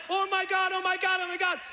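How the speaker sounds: mock terrified chant; panicked. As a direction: babble it in free-fall panic. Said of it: "it's just like".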